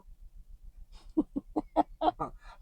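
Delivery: high airy giggle; breathy laugh. Real, not acted. Laughing at Siri.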